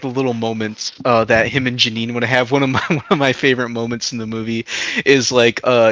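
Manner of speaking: ordinary talk, nothing marked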